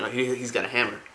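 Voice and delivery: normal talking voice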